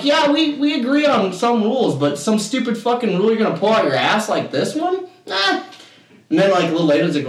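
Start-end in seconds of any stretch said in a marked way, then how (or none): 0.0-5.6 high-pitched, incredulous objection; retold indignation. Player rejects a made-up rule, indignant. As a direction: argue back, voice pitched right up. Acted quarrel over pool rules.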